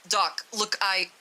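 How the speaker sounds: plain reading voice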